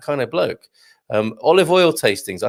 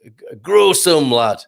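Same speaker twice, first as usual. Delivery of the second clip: put-on a light northern accent, a touch louder; mock gruff northern gardener, grow some lad; a hint of a no-nonsense northern gardener; 3 semitones higher, 4 dB louder than usual. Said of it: "in his unforgiving northern style".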